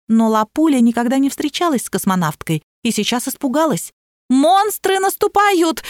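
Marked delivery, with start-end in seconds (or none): 4.3-5.8 high, drawn-out alarm call; panicked cry. A narrator's reading. Barbie warning that monsters are attacking, high panicked cry. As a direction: wail it out slowly in high-pitched panic. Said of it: "кричала Барби".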